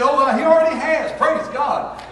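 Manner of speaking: high, loud happy praise; joyful praise. Retold or imitated. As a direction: shout it out, thrilled. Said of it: "she said".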